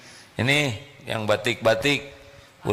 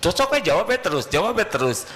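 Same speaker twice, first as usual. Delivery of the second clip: exasperated wife; 8 semitones higher, faster than usual. Retold or imitated. Wife snapping at husband who answers back, fast.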